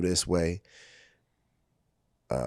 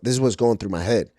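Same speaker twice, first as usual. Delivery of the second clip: usual speaking voice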